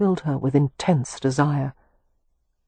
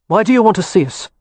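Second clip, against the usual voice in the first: fast, louder and curt; brusque question; 4 semitones higher, 6 dB louder, faster than usual. A narrator's reading. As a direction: cut him off bluntly. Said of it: "Kev asked brusquely".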